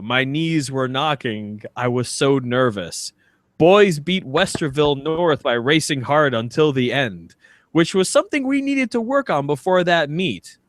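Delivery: ordinary delivery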